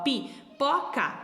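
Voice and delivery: pipoca slowly in three separate sounds; syllable by syllable. Shown as a demonstration. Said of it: "são três sonzinhos". (pipoca devagar, em três sílabas separadas)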